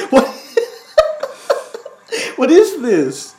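high, loud, laughing disbelief; laughing outcry of disbelief; shocked disbelief. Real, not acted. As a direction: recoil at something horrifying. Shocked by the video.

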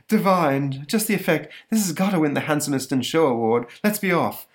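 high, preening monster; vain monster. Retold or imitated. Monster admiring its own curlers, high and preening. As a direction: preen in the mirror, delighted. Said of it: "monster admiring itself".

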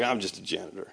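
low, soft, dismissive mumble of oneself; self-belittling. Retold or imitated. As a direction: play yourself down quietly. Voices a self-belittling listener before Christ.

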